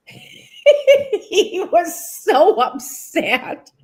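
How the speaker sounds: laughing through the words, high and loud; can barely get it out for laughing, slow. Real, not acted. Retelling how upset Harry was, laughing.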